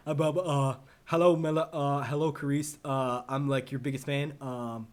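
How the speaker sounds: stammering, awkward fan act; mock tongue-tied. Fan meeting a famous celebrity, mock tongue-tied. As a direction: stumble through it with ums and false starts. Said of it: "I'll clam up like a little bitch".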